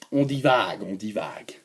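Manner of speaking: very low, soft murmur; accent dropped. Pretend. Repeating "on divague" after losing the accent, low and soft. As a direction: sink deep and quiet on each word. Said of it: "j'ai réussi à perdre mon accent".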